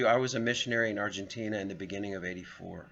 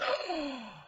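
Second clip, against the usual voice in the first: shocked gasp; 3 dB quieter than usual. Retold or imitated. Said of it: "they were just".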